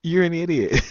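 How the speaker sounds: exasperated inner thought. Retold or imitated. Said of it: "I'm thinking".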